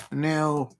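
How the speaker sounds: ordinary delivery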